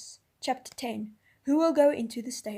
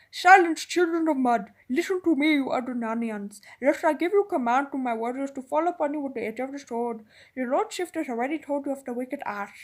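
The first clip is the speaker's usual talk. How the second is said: commanding threat; 5 dB louder than usual. A narrator's reading. The Tarkaan threatens the Narnians, loud and menacing. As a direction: threaten the beasts with swords. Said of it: "thundered Rashta Khan".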